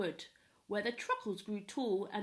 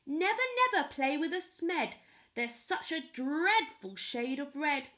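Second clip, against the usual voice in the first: higher, louder grandmother scolding; 4 semitones higher, 4 dB louder than usual. Acted. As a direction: wag a finger at the kids. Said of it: "this is how she spoke her mind".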